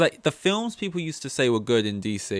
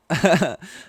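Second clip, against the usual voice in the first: mock laugh; 6 semitones higher, 6 dB louder than usual.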